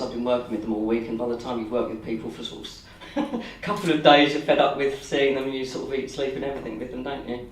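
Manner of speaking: ordinary delivery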